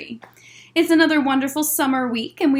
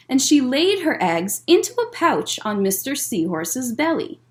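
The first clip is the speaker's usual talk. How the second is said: gentle picture-book storytelling voice; reading to children. A narrator's reading. Librarian reads Mister Seahorse aloud to children, gentle storytelling.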